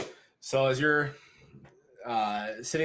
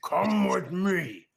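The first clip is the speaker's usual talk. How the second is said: slow, higher, louder command to a guard; barked order; 4 semitones higher, 3 dB louder, slower than usual. Acted. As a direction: bark it like a warlord setting a sentry. Orc leader orders his men.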